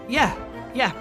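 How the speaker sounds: ordinary delivery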